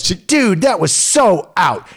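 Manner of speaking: high, loud mock outburst of the mother; mocking the angry mom. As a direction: squeal it like an outraged hockey mom. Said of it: "she goes".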